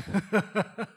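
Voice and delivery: short burst of real laughter; amused chuckle. Real, not acted. Preacher joking to congregation, amused chuckle. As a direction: chuckle at your own joke. Laughs at own sarcastic joke.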